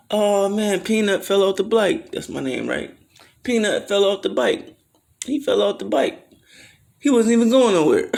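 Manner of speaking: put-on high, loud sing-song teasing of a kid who fell; mocking kids. Kids laughing at Peanut falling off the bike, high mocking taunt. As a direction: taunt him like a schoolyard kid.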